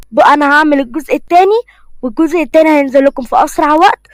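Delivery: normal talking voice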